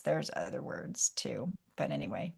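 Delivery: whispered aside; softly whispered afterthought. Real, not acted. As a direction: drop to an unvoiced whisper. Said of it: "quiet aside".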